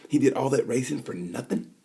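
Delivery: ordinary talk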